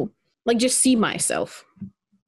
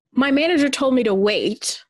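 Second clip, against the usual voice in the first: ordinary talk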